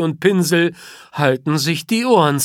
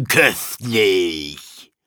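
much lower, licking-the-lips voice; savouring lion; 11 semitones lower than usual. A narrator's reading. King Kofi licking his chops, low and greedy. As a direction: taste the word like a treat. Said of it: "King Kofi schleckt sein Maul".

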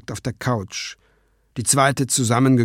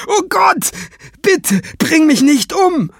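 terrified pleading; 8 dB louder, slower than usual. Acted. Terrified man pleads for life.

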